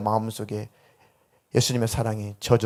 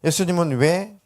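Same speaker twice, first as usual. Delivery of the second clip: normal talking voice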